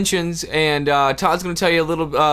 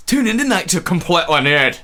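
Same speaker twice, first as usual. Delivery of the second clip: mock Scottish channel promo; Scottish TV announcer; 3 dB louder than usual. Scottish Playboy Channel promo, hammed-up accent. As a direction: plug the show in a joke Scottish brogue. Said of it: "Playboy Channel for fucking Scottish dudes".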